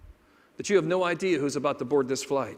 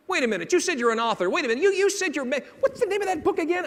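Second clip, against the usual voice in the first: fast, rising, high-pitched questioning; excited stranger; 11 semitones higher, 3 dB louder, faster than usual. Retold or imitated. Total stranger quizzes the author after landing, excited and rushed. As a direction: fire off questions, pitch climbing as you go.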